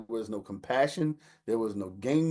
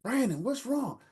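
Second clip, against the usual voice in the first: high, pleading mom voice; worried mother. Retold or imitated. Mother asking her crying son what happened, high and anxious. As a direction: coax the boy to talk.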